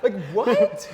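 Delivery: high, giggling mock cluelessness; laughing quote. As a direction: laugh while voicing your lost teen self. Laughing, playing his clueless teen self.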